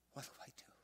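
a desperate whisper of what do I do?; whispered plea. Retold or imitated. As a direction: whisper what do I do? as if too scared to say it aloud. Daughter's desperate plea retold.

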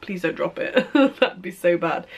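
ordinary talk, nothing marked

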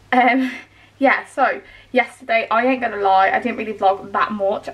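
normal talking voice

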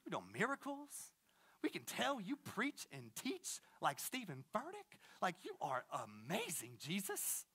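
soft, swinging-pitch mock flattery; hammed-up bro-style Pharisee; schmoozing spiritual talk. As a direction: imitate a bro buttering up a teacher, voice kept down. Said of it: "to make spiritual talk".

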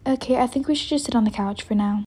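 normal talking voice

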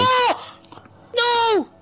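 high panicked shouts; panicked scream. Acted. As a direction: yelp in alarm. Character attacked in game.

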